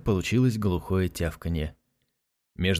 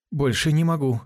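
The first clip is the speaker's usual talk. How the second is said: panting between words; winded after the jumping; out of breath; 6 semitones higher, 3 dB louder than usual. Acted. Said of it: "проговорил запыхавшись Касмаля".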